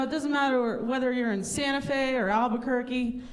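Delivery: usual speaking voice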